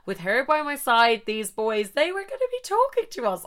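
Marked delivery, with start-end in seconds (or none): 1.9-3.4 high, sing-song boast; mock confident singsong. Bragging to listeners about the boys, high singsong. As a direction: sing it like it's a sure thing.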